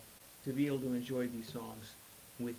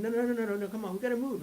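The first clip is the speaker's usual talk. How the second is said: urgent protest; 9 semitones higher, 6 dB louder, faster than usual. Retold or imitated. Urging crew to move patient.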